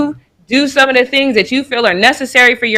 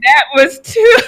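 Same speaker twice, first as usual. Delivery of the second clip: helpless real laughter, pitched up; cry-laughing; 8 semitones higher than usual. Real, not acted. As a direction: squeeze the words out through laughter. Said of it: "I'm about to cry laughing".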